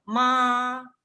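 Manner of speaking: sustained high flat tone on "ma"; first tone. Shown as a demonstration. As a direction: hold "ma" steady at the top of the voice. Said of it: "lo más alto de mi voz".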